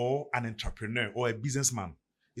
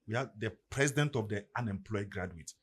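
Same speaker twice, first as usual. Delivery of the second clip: ordinary talk, nothing marked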